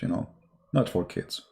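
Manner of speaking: ordinary delivery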